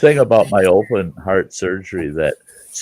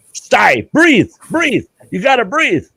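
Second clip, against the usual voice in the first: much higher, urgent mock yell; retold nurses yelling; 11 semitones higher than usual. Nurses yelling at him to breathe, higher urgent mock yell. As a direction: bark the orders at the patient. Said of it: "yelling at me".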